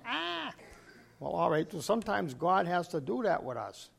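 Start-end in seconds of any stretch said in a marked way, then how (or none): 0.0-0.5 short high mock cry of submission; mock yelp. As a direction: imitate a pinned wrestler's yelp. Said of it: "you can hear them yelling".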